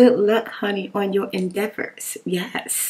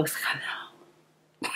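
slow breathy whispered "hello"; romantic French whisper; 14 dB quieter, much slower than usual. Retold or imitated. Mocking how French people say hello, in a slow whisper. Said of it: "the French people speak just like romance".

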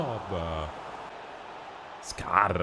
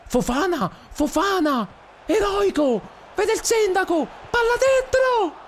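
much higher, squeaky play-by-play; Mickey Mouse voice; 5 dB louder than usual. Acted. Streamer commentating his game in a squeaky Mickey Mouse voice. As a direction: call the match in a cartoon mouse squeak. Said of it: "voce alla Topolino".

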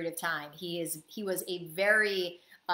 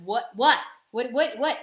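louder frantic repeated what; retelling a panicked call to the boss; 3 semitones higher, 8 dB louder than usual. Retold or imitated. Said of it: "put me in a panic attack".

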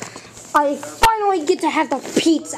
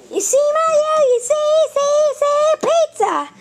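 high sing-song teasing; high taunting voice; 10 semitones higher than usual. Acted. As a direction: tease Mario in a high voice. Taunting Mario.

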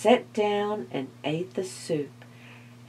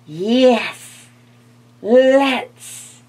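loud, eager skeleton cry; 5 semitones higher, 9 dB louder than usual. A narrator's reading. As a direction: cry out, keen to go scare. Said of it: "cried the skeleton".

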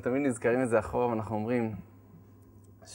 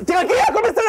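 much higher and louder, strained calm; frantic reassurance; 9 dB louder, faster than usual. Retold or imitated. Husband calming his stressed pregnant wife, frantic. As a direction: soothe her while panicking.

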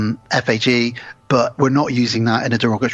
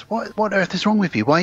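higher, lightly voiced wife; a touch of exasperated concern; wife fretting at her husband, mildly mimicked; worried wife; 7 semitones higher than usual. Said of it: "But she says".

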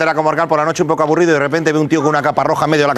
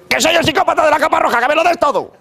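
much higher silly villain voice; a hammed-up menacing psychopath, pitched way up; psycho robber voice; 11 semitones higher than usual. Red-cape robber insists.

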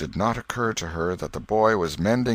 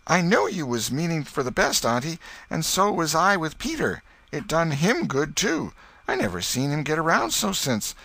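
higher boy voice, sly and mock-earnest; a boy teasing his aunt with her own words; twinkling boy; 9 semitones higher than usual. Tom answers his aunt slyly.

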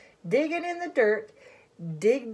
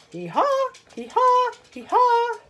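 loud, high hee-haws; high-pitched donkey call; donkey bray; 9 semitones higher, 5 dB louder than usual. Acted. Donkey character brays in story.

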